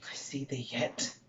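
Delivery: breathless whisper as he sees the dagger; whispered in dread; awed whisper. Acted. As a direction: hush it, transfixed.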